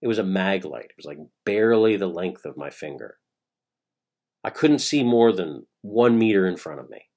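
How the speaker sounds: ordinary delivery, nothing marked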